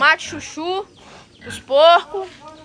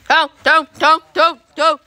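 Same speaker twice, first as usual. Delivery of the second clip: loud, evenly repeated short call; calling animals; 4 dB louder, much faster than usual. Real, not acted. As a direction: summon the pig in brisk bursts. Calling the pig back. (chamando o porco em voz alta)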